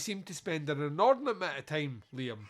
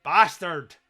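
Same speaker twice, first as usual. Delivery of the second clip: loud Yorkshire farmer's curse; Yorkshire accent; 2 semitones higher, 12 dB louder than usual. Acted. Farmer hears thunder and lightning, loud curse in a Yorkshire accent. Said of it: "I've done it in a Yorkshire accent".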